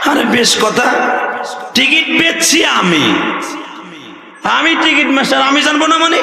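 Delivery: indignant retort. Acted. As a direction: scoff at the question. (উঁচু গলায় অবাক তাচ্ছিল্য)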